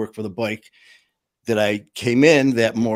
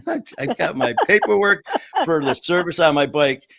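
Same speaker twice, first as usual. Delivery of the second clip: laughing; 6 semitones higher, 5 dB louder than usual. Real, not acted. Laughing at the punchline.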